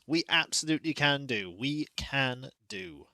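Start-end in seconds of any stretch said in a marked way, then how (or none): 1.6-3.1 slow repeat. Shown as a demonstration. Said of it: "repeated line, two takes".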